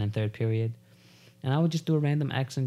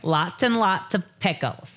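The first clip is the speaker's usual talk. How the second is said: put-on high, drawn-out Valley girl; mock wasted-girl voice; 7 semitones higher, slower than usual. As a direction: order slowly as a tipsy customer.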